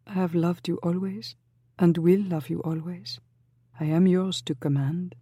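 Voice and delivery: put-on dreamy, devoted young lady; lightly voiced young woman.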